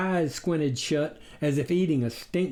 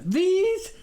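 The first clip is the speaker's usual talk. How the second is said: surprised; 4 dB louder than usual. A narrator's reading. Visitor showing wings proudly.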